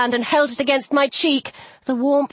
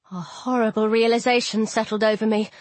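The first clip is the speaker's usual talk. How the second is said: ordinary delivery, nothing marked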